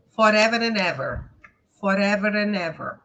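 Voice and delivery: "Forever and ever" as one linked chain; no gaps inside "Forever and ever"; words joined up. Shown as a demonstration. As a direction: join R to "and" and N to "ever". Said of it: "Eles vão juntando".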